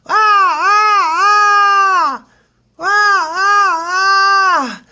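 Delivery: very high, loud monkey shrieks; monkey screaming. Retold or imitated. Storyteller imitates the monkey still screaming at the other, shrieking. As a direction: scream in bursts like an agitated monkey. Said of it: "still screaming".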